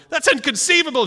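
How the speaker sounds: high, loud outburst of disbelief; mock outrage, high and loud; Vizzini-style disbelief. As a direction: blurt it out as if the idea is absurd. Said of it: "They're going".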